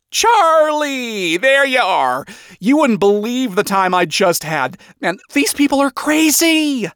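high, animated gushing; frazzled father. Acted. Father finding Charlie after a crazy time, frazzled. Relieved father finds daughter.

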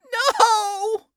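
a high, loud scream of grief; anguished cry. Acted. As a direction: cry out in despair. Daughter sees father die.

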